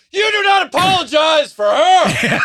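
mock outraged shout. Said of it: "I would have been like".

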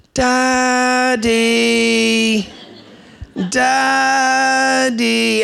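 put-on high, drawn-out little child's call of "Daddy"; small child calling for Dad in the dark; child calling at night. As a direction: call out like a sleepy kid from the next room. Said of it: "the voice went something like this".

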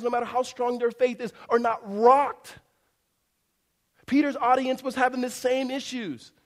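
high, loud, driving sermon delivery; forceful preaching. Real, not acted. Preacher telling congregation that doubts are okay, forceful. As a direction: preach it with full-voiced urgency.

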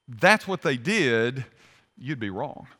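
ordinary talk

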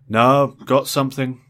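muffled voice. Acted. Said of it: "his muffled voice assured me".

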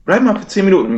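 high mock pleading voice; begging trolls. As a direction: beg in a squeaky high voice, mocking them. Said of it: "ein paar neue Typen sagen". (Trolle im Chat betteln, hohe spöttische Flehstimme)

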